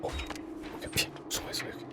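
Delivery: urgent hiding whisper. Real, not acted. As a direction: whisper so the monster can't hear. (다급한 속삭임)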